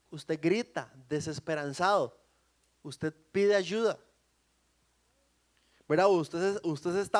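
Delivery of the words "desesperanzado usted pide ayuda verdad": normal talking voice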